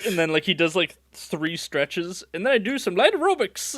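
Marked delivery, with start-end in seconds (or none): 2.3-3.8 high-pitched hammy backpedalling; put-on high voice, improvising defensively; flustered excuse-making. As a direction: bluff through a made-up explanation. Same fighter's flustered explanation.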